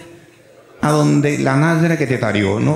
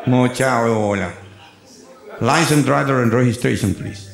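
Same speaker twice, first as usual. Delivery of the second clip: slow, lower American cop voice; American cop English; 4 semitones lower, slower than usual. Retold or imitated. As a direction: drawl the request like a bored cop. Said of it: "ahí empieza un inglés raro".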